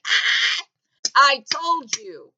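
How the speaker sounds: high loud gloating yelp, slow finish. Pretend. Comic gloating at the crash, loud and slow. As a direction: crow it out, stretching the last words. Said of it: "I'm gonna be like".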